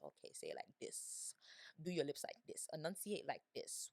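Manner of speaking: soft, low, half-whispered instructions; hushed self-coaching. Retold or imitated. Repeating after the show's heroine, hushed self-coaching. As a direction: coach under your breath, very quietly. Muttering along to the show, coaching herself.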